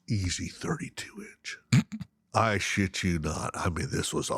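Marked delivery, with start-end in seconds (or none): 0.1-1.6 breathless whisper of awe; awed whisper. Real, not acted. Telling a friend about the monster fish, in an awed whisper. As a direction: hush it like the fish might hear.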